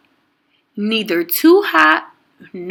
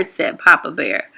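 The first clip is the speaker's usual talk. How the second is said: ordinary delivery, nothing marked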